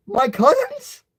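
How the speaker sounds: very high, delighted squeal of a question; happy voice. A narrator's reading. Nephew learning cousins are coming, happy. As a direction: leap up in pitch with happy surprise. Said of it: "you say in a happy voice".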